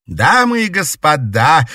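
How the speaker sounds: loud, high, thrilled announcing; excited announcement. A narrator's reading. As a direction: announce it with delight. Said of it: "восторженно объявила".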